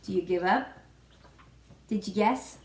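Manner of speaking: ordinary talk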